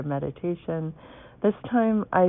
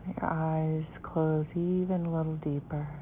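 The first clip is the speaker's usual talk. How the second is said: guided meditation; 9 dB quieter than usual. Acted.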